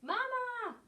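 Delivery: very high, bright cry of a newborn gosling; baby gosling cry. Acted. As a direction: squeal it like a chick seeing its mother. Gosling hatches, calls bear.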